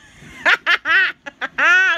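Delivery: loud giggling fit; ticklish laughter. Real, not acted. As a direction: laugh helplessly while tickled. Being tickled.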